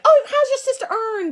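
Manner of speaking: high-pitched mock Southern chitchat; imitating Southern accent. Southerners asking after her sister, mock Southern accent. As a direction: gush in a put-on Southern accent. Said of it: "they're always like".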